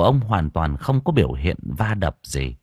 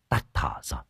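hushed; 8 semitones lower, 9 dB quieter, faster than usual. Acted. Villager reports the death.

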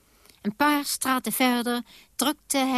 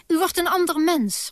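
glad exclamation; 6 semitones higher than usual. A narrator's reading. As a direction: cry it out with delight. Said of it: "riep hij blij". (blij en luid uitgeroepen)

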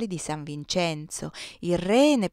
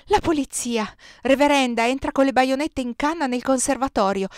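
raised, high urgent warning; alarmed cry; 6 semitones higher, 6 dB louder than usual. A narrator's reading. Maria Giuseppa bursting in to warn the reverenda, alarmed cry. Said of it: "gridò Maria Giuseppa".

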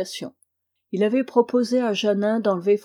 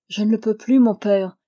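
usual speaking voice

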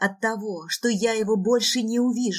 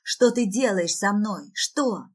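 ordinary delivery, nothing marked

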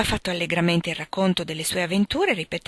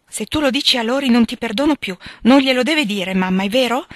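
higher, louder alarmed plea; frightened plea; 5 semitones higher, 5 dB louder than usual. A narrator's reading. Meg begs her mother not to let Joe tell Lori, alarmed. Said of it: "esclamò spaventata Meg".

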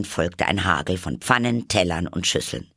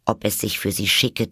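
usual speaking voice

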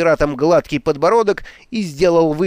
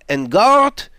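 high, loud duelling cry; battle yell; 6 semitones higher, 5 dB louder than usual. A narrator's reading. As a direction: yell it out as you charge. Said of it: "с воплем". (боевой вопль)

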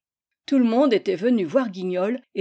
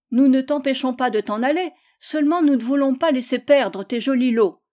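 put-on higher, louder children's retort; insistent children; 4 semitones higher, 6 dB louder than usual. The children pursue Gisèle for her prizes, insistent. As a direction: answer back like teasing children.

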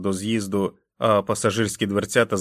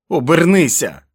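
higher, louder barked order; angry command; 7 semitones higher, 9 dB louder than usual. Acted. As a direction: shout him round. Jack yells at wolf. (гнівний наказ)

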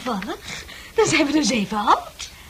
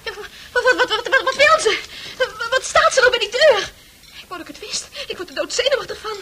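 high, stammering and agitated; nervous stammer; 9 semitones higher, 4 dB louder than usual. Acted. Anxious patient frets about the woman at the door, high and stammering. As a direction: get more and more jittery.

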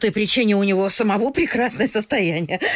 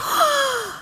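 sharp high gasp of amazement; astonished gasp. Acted. Mother gasps at the news of a governor's son. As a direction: catch your breath in delight.

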